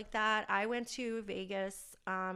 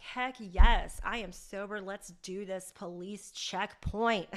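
loud burst of glee easing into emphatic, drawn-out words; excited retelling; 7 dB louder, slower than usual. Retold or imitated. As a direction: reenact eager excitement, punching the opening loud. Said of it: "And I was so excited".

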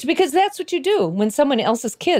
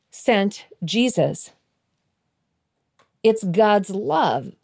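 normal talking voice

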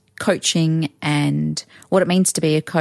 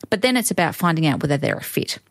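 ordinary talk

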